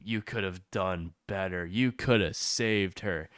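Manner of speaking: low, soft, deadpan game hero voice; mock MC line. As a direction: mumble it like a stiff game protagonist. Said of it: "the M C's like".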